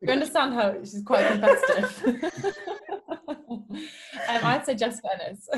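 laughing. Real, not acted. Teasing sister through laughter.

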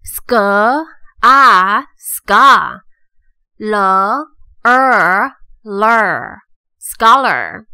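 "scholar" built as "sk", loud "ah", "ska", then "ler"; sounding out syllables. Shown as a demonstration. Teacher reviews scholar syllable by syllable, sounding out. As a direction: piece "scholar" together by sound, pausing after each part. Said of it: "再来复习一次".

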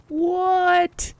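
a very high, loud squeal of disbelief; startled high yelp; shocked what. Real, not acted. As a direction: shriek it in total disbelief. Shocked at the people magnet.

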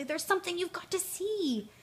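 high, eager urging; can't wait to show them; bouncing excitement. A narrator's reading. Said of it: "said Tigger, bouncing excitedly".

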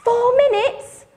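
high alarmed question. Acted. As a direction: sound shocked at the time. Alarmed storyteller.

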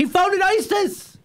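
very high, slow hammered-out imitation; mock insistent outburst. Host topping the candidate's repeated claim, mock outburst.

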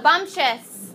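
ordinary delivery

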